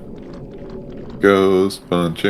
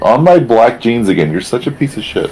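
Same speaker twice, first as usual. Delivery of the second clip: scolding the cat; 6 semitones higher than usual. Real, not acted.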